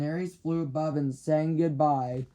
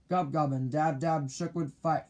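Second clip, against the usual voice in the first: plain reading voice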